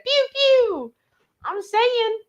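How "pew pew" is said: high playful pew pew blaster noise; mock gun sounds. Reading a viewer's comment aloud, high playful pew pew. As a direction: fire a toy laser gun, pitched way up. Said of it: "And Renee said".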